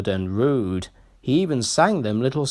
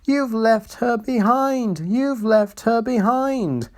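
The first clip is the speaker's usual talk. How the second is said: high, slow wailing coaches; 10 semitones higher than usual. A narrator's reading. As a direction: draw it out in a high wail. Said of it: "wailed the coaches".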